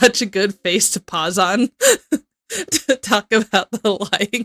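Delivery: words squeezed out through real laughter, slowed; laughing while talking. Real, not acted. Cohost laughing at a paused video face. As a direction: crack up mid-sentence.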